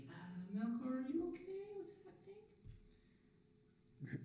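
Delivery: high, soft worried voice; Albin Heffing. Acted. Game master voices a companion heard over the bug swarm, high and worried. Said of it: "you can hear the sound of Albin Heffing being like".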